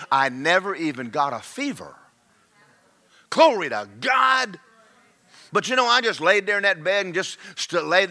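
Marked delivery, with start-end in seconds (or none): 3.3-4.6 higher, slow exclamation of praise; praise shout. Real, not acted. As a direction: lift it up as a joyful cry to God. Preacher praising after healing story.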